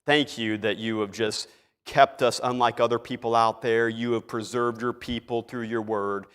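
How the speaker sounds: low, soft, pompous prayer voice; nice eloquent voice. Acted. Preacher mocks Jack's showy prayer at the pulpit, low and pompous. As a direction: pray grandly to impress the room. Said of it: "gets in that nice, eloquent voice".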